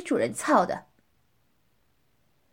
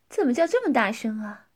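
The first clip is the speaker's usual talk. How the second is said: normal talking voice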